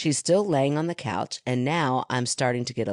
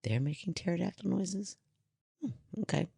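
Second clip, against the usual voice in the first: quiet puzzled read; 5 semitones higher, 10 dB quieter than usual. Real, not acted. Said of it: "oh, funny".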